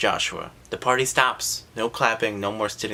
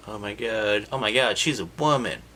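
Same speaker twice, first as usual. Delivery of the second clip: normal talking voice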